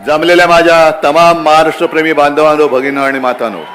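booming rally opening; fiery rally speech. Acted. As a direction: roar it to the crowd.

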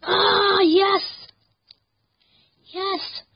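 high, very loud cheer; triumphant scream. Real, not acted. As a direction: explode with joy at a win. Risky move pays off.

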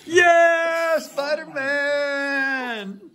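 high, long-held whooping cheer; drawn-out cheer. Real, not acted. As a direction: cheer it high, stretching the name out slowly. Cheering a game answer.

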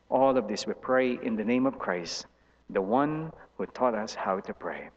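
normal talking voice

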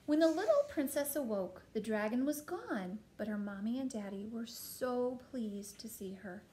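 put-on animated reading to a child, swooping pitch; storybook narration. A narrator's reading.

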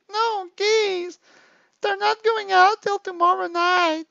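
put-on high, whiny, needy girlfriend voice; clingy girlfriend. Girlfriend reading her needy messages aloud in a high whine. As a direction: plead like a lonely girlfriend in a high voice.

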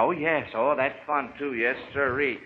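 ordinary delivery

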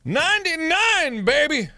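high, loud triumphant whoop; excited cheer. Real, not acted. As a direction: shout it out in celebration. Celebrating a level ninety-nine.

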